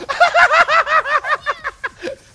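long, loud, high laugh; high laughing fit; big cackle. Real, not acted. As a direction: cackle hard at your rival. Laughing hard at rival.

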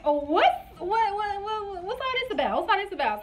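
high, stuttering disbelief; mock alarm. Laboring woman startled by stronger contractions, mock alarm. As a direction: stammer it high, as if caught off guard.

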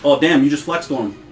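usual speaking voice

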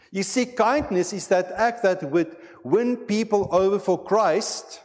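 rising sermon fervour; full preaching voice. Acted. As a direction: preach it out to the congregation with conviction. Preaching on fruits of Spirit.

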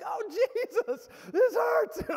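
high, loud pained wail; cry of pain. Retold or imitated. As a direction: re-enact crying out in agony. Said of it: "it was so painful".

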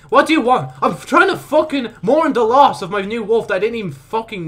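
high, loud indignant rant; worked-up, loud, high complaining; exasperated anger. Real, not acted. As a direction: lash out at an interruption. Snaps at pestering while mourning wolf.

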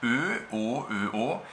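same lip shape, tongue moves from front Ö to back O; Ö and O contrast. Shown as a demonstration. Teacher showing Ö and O with the same lips. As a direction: show learners the tongue shift. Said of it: "la forma de la boca".

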